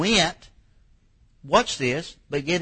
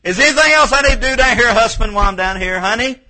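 high, loud call of a wife down the stairs; 10 semitones higher, 11 dB louder, faster than usual. Acted. Wife calling to husband while down on her knees.

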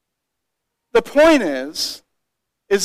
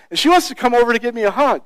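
ordinary talk

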